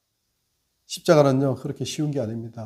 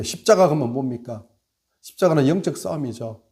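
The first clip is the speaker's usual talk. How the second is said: ordinary talk, nothing marked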